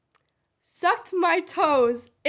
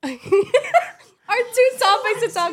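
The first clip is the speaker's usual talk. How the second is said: laughing; 7 semitones higher, 6 dB louder, slower than usual. Real, not acted. Laughing announcement.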